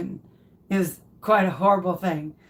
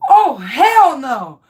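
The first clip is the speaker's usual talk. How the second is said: loud, slow "Oh, hell no"; loud mock refusal; 3 semitones higher, 8 dB louder, slower than usual. Wife's reaction to the twelfth blanket, loud mock refusal. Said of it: "I was like".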